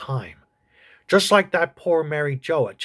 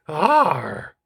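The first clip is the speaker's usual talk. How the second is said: creature grunt. Acted. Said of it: "You're right, Agnew".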